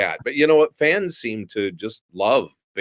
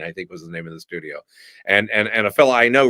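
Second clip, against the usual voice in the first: normal talking voice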